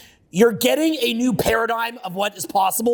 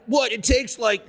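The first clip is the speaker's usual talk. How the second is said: higher-pitched, drawn-out mocking whine; whiny impatient complainer; 5 semitones higher, slower than usual. Speaker mocking viewers who want him to get to the point, whiny impatient complainer. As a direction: drag it out like a griping, exasperated viewer. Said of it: "People be like".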